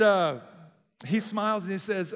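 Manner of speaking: ordinary talk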